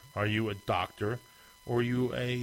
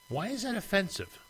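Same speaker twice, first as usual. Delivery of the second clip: much higher, disbelieving question; incredulous; 9 semitones higher than usual. Real, not acted.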